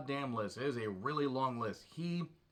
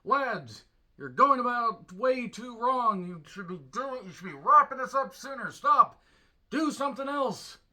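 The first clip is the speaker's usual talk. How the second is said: exasperated lecturing; 9 semitones higher, 7 dB louder than usual. Retold or imitated. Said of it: "Crimean War was looking at this thinking".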